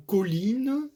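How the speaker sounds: colline with the accent on li; stress on li. Shown as a demonstration. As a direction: lean on the second syllable of colline. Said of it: "l'accent sera sur li". (« colline » accentué sur li)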